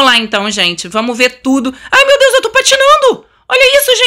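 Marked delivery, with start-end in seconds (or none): 1.9-4.1 very high, thrilled shriek; excited squeal. Real, not acted. Player finds herself skating on the lake, squealing excitedly. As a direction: squeal it out in sheer delight.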